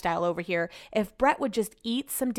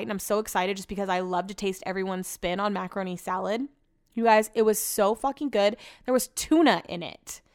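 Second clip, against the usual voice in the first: ordinary talk, nothing marked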